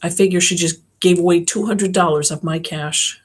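ordinary delivery

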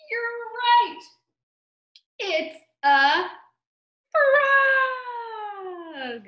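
high, loud, delighted announcement; big storytime reveal; excited reveal. Pretend. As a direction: stretch the final word triumphantly. Revealing the answer to children.

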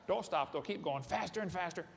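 ordinary talk, nothing marked

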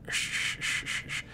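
soft, voiceless repeated shh; short bursts of shushing; hissed shushing sounds. Acted. Reader voicing a morning sound effect, soft shushing.

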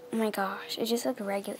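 ordinary talk

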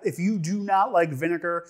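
ordinary delivery

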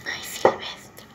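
slow breathy whisper of his name; a slow sigh of a whisper. Acted. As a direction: breathe the name out softly, barely voiced. Tender aside to Silvestre.

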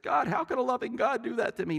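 high, pleading shake-the-fist complaint; high protest to heaven; mock angry cry at God. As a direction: rail at God, hands up. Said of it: "Does he shake his fist?".

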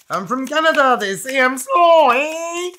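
high, loud mock Canadian accent with eh; Simpsons Canadian kid. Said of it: "It's just the way he says it".

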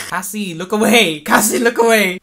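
loud, high, insistent yell; urgent warning shout. Acted. Warning Cassie to look away, urgent shout.

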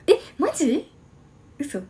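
high, loud surprise; surprised. Real, not acted. Surprised reaction to chat about a banner. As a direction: blurt it out.

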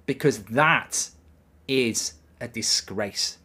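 slow, low, measured disgust; deliberate, deflated verdict; grave condemnation. Real, not acted. Grave verdict on the heavy defeat.

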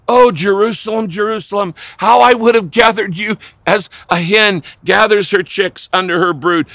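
loud, high, impassioned lament; passionate cry. Acted. Jesus crying out over Jerusalem from a hill, passionate cry. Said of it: "in passionate cry".

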